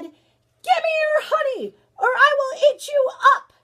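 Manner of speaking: very high, loud storybook bear roar; big bear threat. Acted. Bear jumps out at grandfather for his honey, roaring threat. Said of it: "great big bear jumped out and said".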